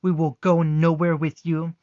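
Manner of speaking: loud, sharp refusal spat at the soldiers; mother refusing the soldiers, loud and fierce; hissed defiance. Acted. Mother defying the soldiers, hissing loudly. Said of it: "Juniper absolutely hissed".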